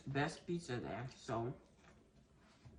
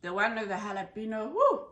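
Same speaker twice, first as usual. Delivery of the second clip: excited; 5 semitones higher, 7 dB louder, faster than usual. Real, not acted. Reacting to spicy bite.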